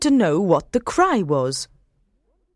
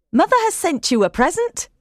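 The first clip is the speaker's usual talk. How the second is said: higher, quick and pleased; maid Martha; 10 semitones higher, faster than usual. A narrator's reading. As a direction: hand over a surprise gift. Martha bringing a gift.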